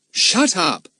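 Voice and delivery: put-on irritated child's rebuke; cross. A narrator's reading. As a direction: cut off the boaster crossly. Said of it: "who always felt cross".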